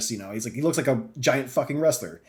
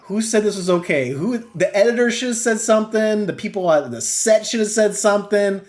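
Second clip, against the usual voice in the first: raised-pitch exasperated disbelief; incredulous outrage; 6 semitones higher, 3 dB louder, slower than usual. Retold or imitated. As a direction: demand to know who let this through. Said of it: "I'm like".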